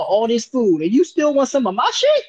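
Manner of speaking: high, loud outrage; outraged high voice. Retold or imitated. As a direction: blurt it high in disbelief. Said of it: "And I'm like".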